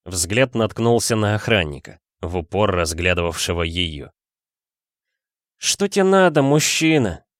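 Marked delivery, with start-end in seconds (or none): 5.6-7.2 put-on drunk woman. A narrator's reading. Drunk Dasha confronts guard.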